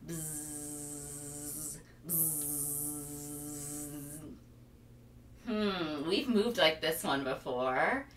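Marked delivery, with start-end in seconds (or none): 0.1-4.2 low buzzing hum; bee buzz. Shown as a demonstration. Teacher buzzing like a bee for kids.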